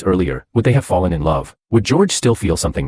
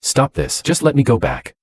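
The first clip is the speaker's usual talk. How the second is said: higher, firm and final; 7 semitones higher than usual. Acted. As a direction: shut her down firmly without yelling. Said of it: "I responded firmly".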